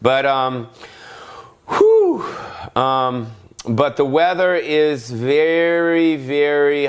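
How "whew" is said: loud, very high whoop of relief; loud high whew. Acted. Host pauses his rant with a loud high whew.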